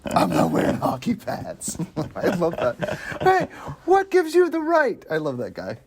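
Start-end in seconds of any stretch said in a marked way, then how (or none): 0.1-1.8 slow, voiceless growly hero impression; Batman-style rasp. Acting out Batman's movie line. 3.2-5.1 slow, high-pitched indignant wannabe hero; chubby little Batman. Acted. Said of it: "It's like a chubby little Batman".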